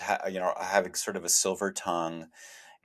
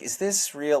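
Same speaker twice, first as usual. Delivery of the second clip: incredulous; 8 semitones higher, slower than usual. Retold or imitated. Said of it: "I was definitely like".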